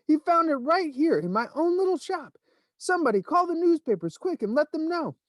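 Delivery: bursting with excitement; excited shopkeeper. A narrator's reading.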